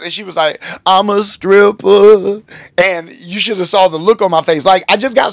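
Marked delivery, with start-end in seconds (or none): 0.9-2.4 loud, high, very drawn-out mock confession; imitated reveal. Woman reveals her job to pastor, drawn-out imitation. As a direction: stretch every word in a raised put-on voice.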